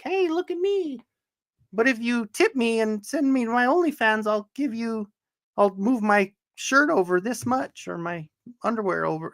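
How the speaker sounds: high put-on come-on voice; mock OnlyFans poster. Mimicking OnlyFans posters seeking tips.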